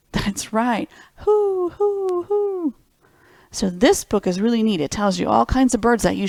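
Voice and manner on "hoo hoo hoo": high, hollow owl hooting; playful high owl call; owl sound. Shown as a demonstration. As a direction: show the kids how an owl hoots. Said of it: "what sound do they make?".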